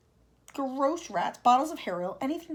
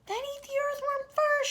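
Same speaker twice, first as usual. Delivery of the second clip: high, slow, mocking shout; cheeky bug yelling to save himself; centipede shouting; 5 dB louder than usual. Centipede shouting to the Cloudman to eat the earthworm. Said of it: "shouted the centipede".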